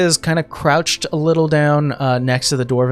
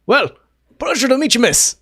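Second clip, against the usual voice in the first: jovial dwarf; 3 semitones higher, 4 dB louder than usual. Acted. Dwarf greets Vera.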